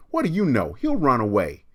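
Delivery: put-on high, loud, snippy kid contradicting; loud whiny brat; defiant bratty girl. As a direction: talk back to the teacher like a sulky girl. Kathy contradicts the teacher.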